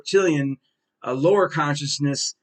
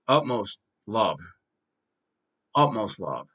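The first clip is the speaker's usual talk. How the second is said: sincere, tender; 5 semitones lower, 4 dB quieter, slower than usual. Real, not acted. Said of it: "I mean this with the utmost love".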